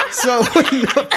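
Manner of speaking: high, loud words through laughter; laughing hard. Real, not acted. Host after his friend's punchline, laughing hard. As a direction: push the sentence out while cracking up.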